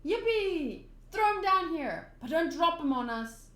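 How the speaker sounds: acted whoop, calling up to someone; excited calling. Friends call up to Luffy on the cliff, excited.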